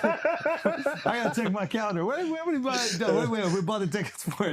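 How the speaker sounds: high, laughing, flustered. Real, not acted. Comedian asked when tickets were bought, laughing high. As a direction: laugh while searching memory.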